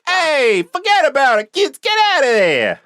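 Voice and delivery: put-on high, loud Brooklyn guy shooing kids; forget-about-it Brooklyn tough guy; Brooklyn accent. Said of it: "I'm like a Brooklyn guy".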